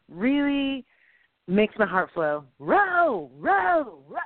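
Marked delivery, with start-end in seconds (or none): 2.6-4.2 drawn-out, high rowing call; high, sustained chant of "row". Acted. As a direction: call the stroke to a crew.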